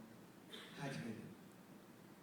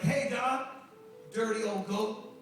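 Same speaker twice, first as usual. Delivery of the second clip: higher, drawn-out mock insult; playful ribbing; 6 semitones higher, 4 dB louder, slower than usual. Pastor greeting his sick friend in bed, playful ribbing. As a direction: tease an old friend affectionately, pitched up.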